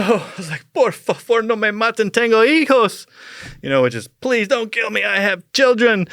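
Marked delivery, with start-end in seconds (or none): 0.7-3.1 high, louder, panicked begging in Spanish; hammed-up terrified plea; mock pleading in Spanish. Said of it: "And I start crying". 4.1-6.1 high, louder, panicked begging; replaying a terrified plea; mock pleading. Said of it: "And I start crying".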